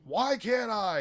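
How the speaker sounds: defiant retort. Retold or imitated. Bill snapping back at the doctor, high defiant challenge. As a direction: throw the question back in angry defiance. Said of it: "She's like".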